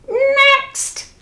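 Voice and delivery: very high squawked "Next"; high parrot screech, ordering. A narrator's reading. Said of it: "ordered the parrot".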